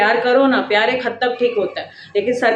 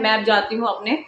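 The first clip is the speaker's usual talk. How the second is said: ordinary delivery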